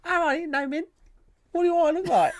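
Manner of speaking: very high squeaky street-urchin voice; scrawny little character. Acted. Scrawny flat-cap kid refusing mint, high squeaky urchin voice. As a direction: pipe up like a scrawny cheeky urchin. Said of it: "just a scrawny little character".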